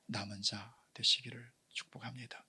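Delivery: hushed preaching whisper. Acted. Pastor whispering the point to the congregation. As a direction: drop to an intimate whisper. Blessing then prayer.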